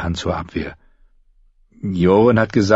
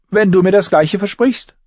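put-on high, loud girlish retort; high-pitched defiant kid; young girl Arya, cocky; 13 semitones higher, 5 dB louder than usual. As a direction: answer the dare in a high girl's voice.

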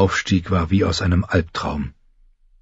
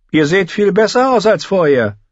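put-on high, haughty boy-king voice; young king Joffrey; 11 semitones higher, 3 dB louder than usual. A narrator's reading. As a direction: look her over like a spoilt king. (hoher, hochmütiger Knabenkönig)

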